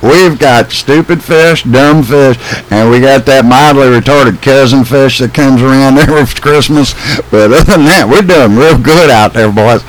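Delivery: put-on southern accent. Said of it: "jumping right to the southern accent".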